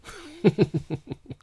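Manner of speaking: high, loud burst of laughing; loud high whoop of laughter; shout of laughter. Acted. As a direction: let out a big hooting laugh. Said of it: "shouted with laughter".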